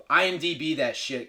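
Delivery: normal talking voice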